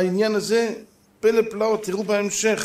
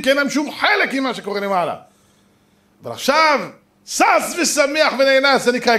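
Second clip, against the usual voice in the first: louder and higher, fervent sermon; full-force preaching; 9 semitones higher, 8 dB louder than usual. Acted. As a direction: drive the lesson home to the congregation. Rousing moral of the sermon.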